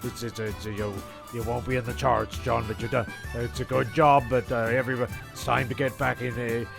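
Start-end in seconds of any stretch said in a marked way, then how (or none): none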